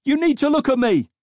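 high, very loud command; shouting. A narrator's reading. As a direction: yell to seize his attention. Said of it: "shouted the officer wearing the body cam".

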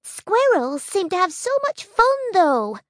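put-on high, cheerful young bee; squeaky apologetic bee; little bee voice. Honey's voice.

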